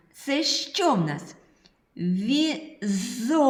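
put-on loud, bright call-and-repeat for little kids; kindergarten teacher. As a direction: project every word to the whole class.

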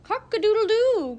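high, loud rooster crow; crowing cock. A narrator's reading. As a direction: crow it out proudly. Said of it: "crowed the cock".

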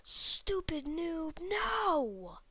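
high desperate cries of no; anguished shouting. Real, not acted. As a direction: yell in real defeat. Losing the match at the end.

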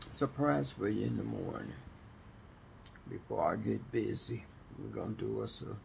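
normal talking voice

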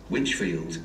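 normal talking voice

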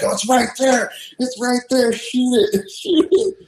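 high, urgent mock shouting without much volume; retold frantic alert. Hunter urges buddies to shoot the bear, acted urgency.

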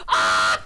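short, loud harsh yell; abrupt raw scream; sudden scream. Real, not acted. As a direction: burst out screaming. Frustrated scream in game.

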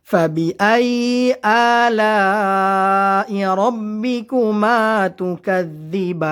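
slow, melodic Quran recitation. Shown as a demonstration. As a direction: chant each word slowly with long vowels. Recites verse slowly.

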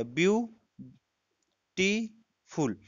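Beautiful very slowly, a pause after each syllable. Shown as a demonstration. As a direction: break beautiful into three stretched beats. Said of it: "यानी तीन syllable". (Beautiful के तीनों syllable अलग-अलग, धीरे-धीरे)

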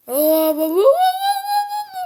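very high sung wah wah wail; muffled wah wah talk. Real, not acted. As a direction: sing the wah wah wail loud. Wailing singsong again.

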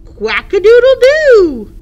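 loud, high rooster-style crow; crowing quack. Acted. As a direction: crow it out proudly. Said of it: "cried Duck".

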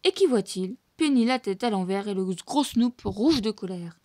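usual speaking voice

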